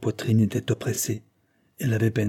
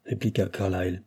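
ordinary delivery

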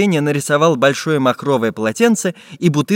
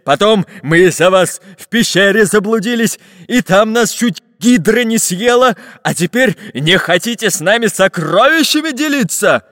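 loud grievance climbing higher as it builds; angry pirate bark; 4 semitones higher, 4 dB louder than usual. A narrator's reading. Pirate cheated of treasure, barking angrily. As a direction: pile up accusations, louder and angrier. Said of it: "Зло рявкнул пират Буль-Буль".

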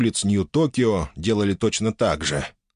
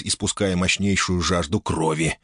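ordinary delivery

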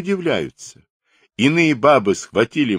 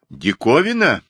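higher, raised questioning call; loud question; 6 semitones higher, 4 dB louder than usual. A narrator's reading. Runaway asking the gaping clerk, loudly. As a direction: ask it out loud, pointedly. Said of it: "громко спросил Петров".

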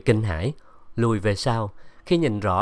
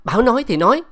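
sudden loud, much higher bark; raised voice; 12 semitones higher, 7 dB louder, much faster than usual. A narrator's reading. Irritated disciple yelling at the crying girl, loud and high. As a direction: snap it out at full volume, high and sharp. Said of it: "đột nhiên cao giọng".

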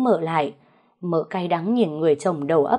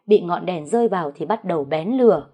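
ordinary delivery

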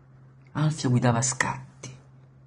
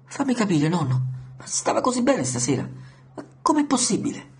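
frightened disbelief; 8 semitones higher than usual. A narrator's reading. Martin shocked by grandma's news.

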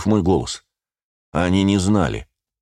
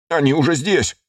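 высокий испуганный выкрик; выпали тревожную весть в панике; панический крик; 9 semitones higher than usual. A narrator's reading. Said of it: "вращал выпученными глазами начальник". (в панике кричит)

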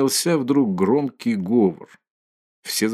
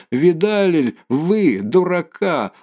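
hysterical scorn; 5 semitones higher than usual. A narrator's reading. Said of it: "истерически захохотала".

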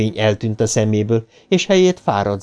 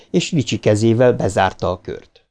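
usual speaking voice

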